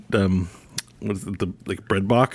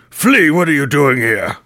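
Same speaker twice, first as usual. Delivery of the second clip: put-on grumpy old wizard; 5 semitones higher, 6 dB louder than usual. Archie surprised on the stairs.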